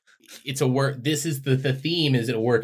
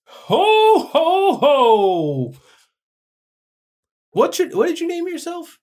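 very high, loud jolly Santa; booming cartoon Santa laugh; Santa Claus voice; 10 dB louder, slower than usual. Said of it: "you're gonna be, uh, Santa".

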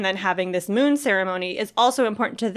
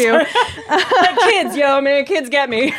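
laughing; 4 semitones higher, 5 dB louder, much slower than usual. Real, not acted. Laughing off her tears.

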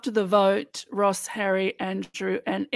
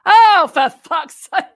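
loud, slow, drawn-out Welsh-free groan of a curse; exasperated shout; 8 semitones higher, 11 dB louder, slower than usual. Retold or imitated. Quoting a contestant who opens the letter, a loud exasperated curse. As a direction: bellow it in exasperation. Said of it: "he picks it up and reads it and he goes".

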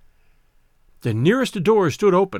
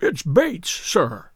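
ordinary delivery

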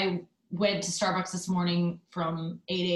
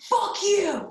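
high, loud retold scream; screamed curse; 13 semitones higher, 5 dB louder than usual. Angry man screaming at the woman leaving. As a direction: yell it right back at her. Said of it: "Like, he screamed it".

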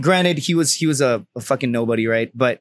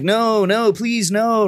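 higher-pitched retold plea; mock pleading; 6 semitones higher, much slower than usual. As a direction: act out begging him to stop. Feigns pleading with attacker.

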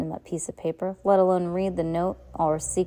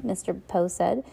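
usual speaking voice

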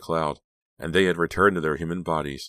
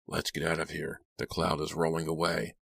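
hushed urgent; 7 dB quieter than usual. Acted.

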